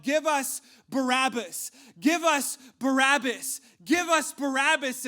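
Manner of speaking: loud, high chant of an angry mob; crowd shouting. Acted. Crowd answers Pilate's choice of prisoner, shouting. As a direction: shout it slowly, punching each word. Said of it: "the crowd began to shout".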